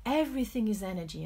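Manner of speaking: usual speaking voice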